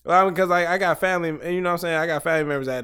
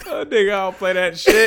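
high, loud laugh spilling into the words; howling laughter; 9 semitones higher, 7 dB louder than usual. Real, not acted. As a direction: laugh hard, then toss off the boast. Howling laughter after threat.

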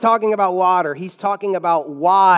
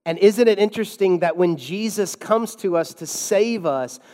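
ordinary talk, nothing marked

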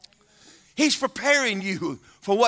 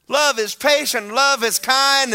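straining to stay patient; 7 semitones higher, 7 dB louder, slower than usual. Acted. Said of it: "you're gonna stand there and go".

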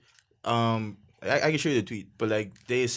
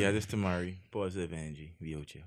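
put-on intro voice; 6 semitones lower, 6 dB quieter than usual. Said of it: "We, we back on that again?".

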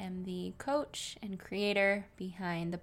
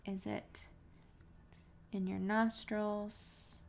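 soft, even meditation voice with long pauses; guided meditation; 9 dB quieter than usual. Real, not acted. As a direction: gently guide listeners into relaxed breathing, unhurried.

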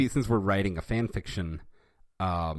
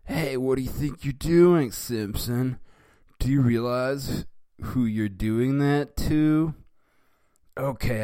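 higher, louder angry bully voice; 5 semitones higher, 6 dB louder than usual. Acted. Nelson confronting Bart after the punch, angry yell. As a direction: threaten him, furious and loud. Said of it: "in anger".

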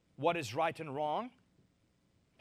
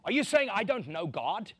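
louder, higher, huffy; offended churchgoer; 4 semitones higher, 6 dB louder than usual. Retold or imitated. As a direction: mimic a defensive believer.

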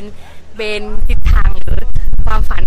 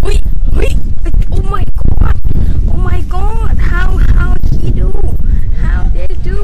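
amazed; 8 semitones higher, much slower than usual. Real, not acted. Amazed at a street performer.

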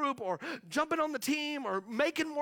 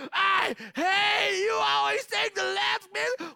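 put-on higher, louder childish outburst; mock yell; 10 semitones higher, 6 dB louder than usual. Sibling fighting over the last biscuit, shouting.

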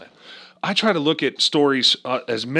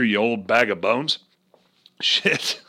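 normal talking voice

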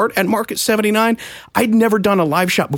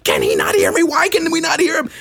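baffled cameraman; 12 semitones higher than usual. Retold or imitated. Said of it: "sitting there with his arms outstretched".

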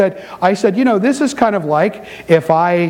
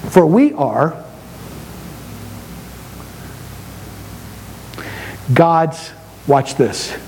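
slow low reading; 4 semitones lower, 6 dB quieter, slower than usual. Acted. Slow, low dramatic scripture reading.

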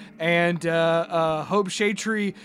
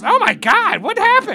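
much higher, louder alarmed cry; panicked high exclaim; 9 dB louder than usual. Acted. Gerby runs over and cries out in alarm, high and loud. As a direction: burst out in frantic alarm. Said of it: "runs over with the plant food and says".

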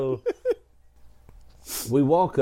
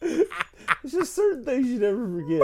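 mock crying; 12 semitones higher than usual. Wailing remembered while speaking.

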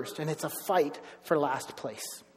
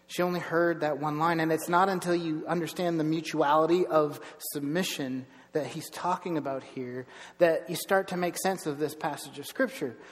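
usual speaking voice

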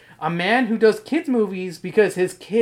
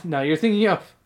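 normal talking voice

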